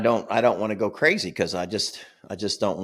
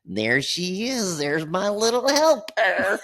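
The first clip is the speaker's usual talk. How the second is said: high, slow, sugary voice for the wife; mock sweet praise, high and drawn out; doting husband voice; 8 semitones higher, slower than usual. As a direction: coo it like a proud husband mocking. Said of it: "and he goes".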